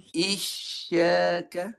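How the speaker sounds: "Isyaka" drawn out very slowly with a clear sh; "Isyaka" slow, with a rising end; with shin. Shown as a demonstration. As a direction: stretch "Isyaka", raising mid-tongue on the sh. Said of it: "Huruf shin jangan guna muncung".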